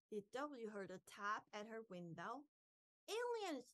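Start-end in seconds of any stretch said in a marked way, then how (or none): 3.1-3.7 high storybook scream. A narrator's reading. D W hears a tap at her window, high scream. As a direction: cry it out high in alarm. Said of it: "she s-, screamed".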